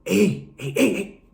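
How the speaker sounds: high, repeated little calls; urgent attention-getting. Retold or imitated. Inmate trying to catch the narrator's attention, high urgent calls. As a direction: pester someone with squeaky heys. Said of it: "That fool would get at me".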